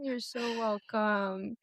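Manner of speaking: soft, slow and heartfelt; tender. Real, not acted. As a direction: receive the thanks gently, drawing it out. Tender answer to heartfelt thanks.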